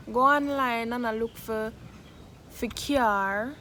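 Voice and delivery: normal talking voice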